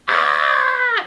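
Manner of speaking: loud low frightened shriek; scream. Acted. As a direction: shriek in terror. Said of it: "Everyone shrieked again".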